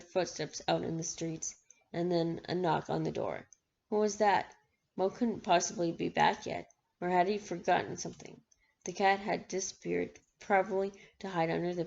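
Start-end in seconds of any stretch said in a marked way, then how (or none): none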